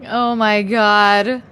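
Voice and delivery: slow, drawn-out oh my God; mock dismay. Real, not acted. Realizing a viewer fooled her, slow mock dismay. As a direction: stretch it in mock disbelief.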